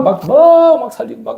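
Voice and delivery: sudden high yelp of a frantic prayer; mock shouting prayer. Preacher imitates childhood shouting prayers, mock yell. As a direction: burst out like a shouting prayer warrior.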